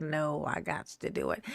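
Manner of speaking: low, soft self-talk; retold low resolve. Streamer retells overruling procrastination, low resolve. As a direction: say it low to yourself.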